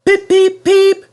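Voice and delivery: very high, loud whistling peeps; engine whistle. Acted. Henry answering the fat controller with a high loud whistle. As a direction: toot it like a happy steam whistle. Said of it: "whistled Henry".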